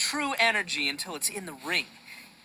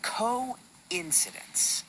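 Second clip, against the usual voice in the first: coincidence said very slowly and softly; drawn-out key word; 5 dB quieter, much slower than usual. Acted. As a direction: let the word hang, slow and soft. There's this word that really sums it up.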